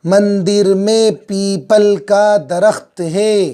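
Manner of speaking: sentence read slowly, word by word; unhurried classroom reading; slow model reading. Shown as a demonstration. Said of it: "क्या पढ़ेंगे".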